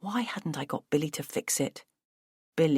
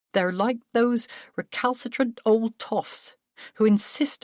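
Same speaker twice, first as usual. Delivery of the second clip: disdainful, higher, lively; dismissive brother; 5 semitones higher, 4 dB louder than usual. Acted. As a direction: sneer at the old toffs. Paul scorns family.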